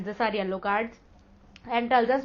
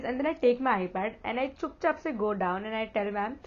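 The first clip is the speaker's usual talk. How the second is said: normal talking voice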